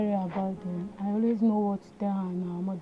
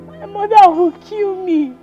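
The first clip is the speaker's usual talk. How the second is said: crying; 5 semitones higher, 7 dB louder than usual. Acted. Woman crying after the fight.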